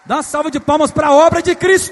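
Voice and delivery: rally them to applaud; loud call. Real, not acted. Pastor to congregation, shouting for applause.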